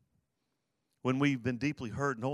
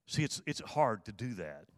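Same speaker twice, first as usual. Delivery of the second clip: usual speaking voice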